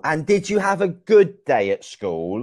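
ordinary talk, nothing marked

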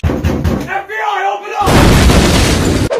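drawn-out, high, full-volume yell; mock FBI raid shout. Gamer joking about an FBI raid, mock raid shout. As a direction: bellow like a joke police raid.

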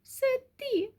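soft, very high mouse voice; tiny high-pitched murmur; barely audible squeak. A narrator's reading. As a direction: squeak it faintly, sheepish little mice. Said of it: "ледве чутно пропищали мишенята".

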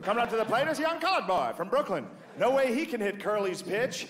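put-on high, old-timey ballgame announcer voice; old radio announcer. Comedian mocking the announcers calling Jackie's at-bat, high announcer voice. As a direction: ham up a vintage radio play-by-play. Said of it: "You can hear the announcers like".

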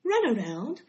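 commanding. A narrator's reading. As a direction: give a brisk command. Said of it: "commanded Charlotte".

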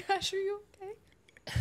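slow, high and tearful; asking gently through her own tears; teary concern. Real, not acted. Tears spread among friends.

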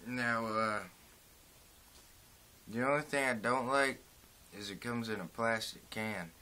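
talking around tobacco pinch; lip pushed out slightly. Real, not acted. Seen 1: Puts pinch to mouth near -3; lower lip then looks bulged.

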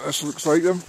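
ordinary talk

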